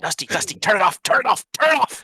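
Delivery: panicked command, climbing to a high shout; urgent shouting. Acted. Player in character begging Dusty to stop it, urgent rising shout.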